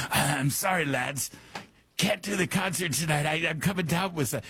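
a hint of a raspy Scottish rocker calling in sick; mock apologetic rock star, lightly voiced. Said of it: "He called in sick".

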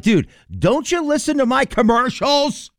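frustrated yell. Retold or imitated. Fed-up host yelling at a pestering friend, exasperated. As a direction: snap at the friend who won't listen. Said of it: "Then I get really frustrated".

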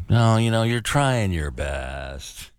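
patronizing, stretched out slowly and sinking; slow, condescending sigh of a line. Shown as a demonstration. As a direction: humor them with slow, fake consolation. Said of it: "phrases that can sound condescending".

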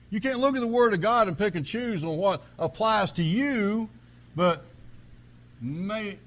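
loud, driving pulpit fervour; full-force preaching. Real, not acted. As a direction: preach it hard to the congregation.

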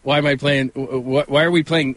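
ordinary talk, nothing marked

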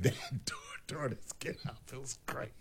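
gleeful whispered aside; laughing under the breath. Real, not acted. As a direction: hush it like a juicy secret.

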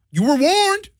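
high, sing-song taunt; playful singsong threat; mock warning. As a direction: tease them with a cheeky warning. Threatening shirt-ripping joke.

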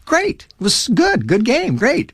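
high, light, no-big-deal approval; offhand praise. Retold or imitated. Dad after son's good baseball game, offhand praise.

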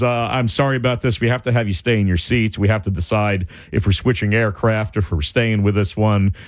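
low pilot voice over the intercom; low, even cabin announcement with filler uhs. Retold or imitated. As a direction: imitate an apologetic pilot addressing passengers. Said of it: "the pilot comes back on".

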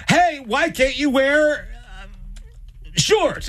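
high, slow, pleading mother; mom's pleading voice. Retold or imitated. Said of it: "And Nina's gonna be like".

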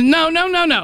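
insistent no. Real, not acted. Cutting off objection.

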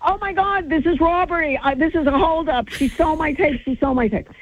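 high-pitched, frantic cries of robbery; frantic outcry. Retold or imitated. Running down the hotel hallways after the stolen tape, frantic high cries. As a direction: yelp it like you've just been robbed. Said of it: "running through the hallways going".

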